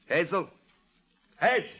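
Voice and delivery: high, loud call across the flat. Acted. George summoning his girl Hazel, loud call. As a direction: bark her name from the kitchen.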